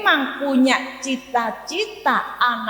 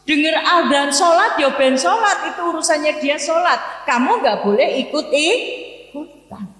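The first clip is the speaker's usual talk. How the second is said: stern mother; 6 semitones higher than usual. Retold or imitated. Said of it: "Ibu saya bilang".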